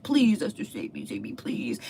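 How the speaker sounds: mock desperate begging, high at first; a lightly hammed-up plea for mercy; pleading. Haman begs Esther for his life, mock pleading. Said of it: "starts pleading for his life".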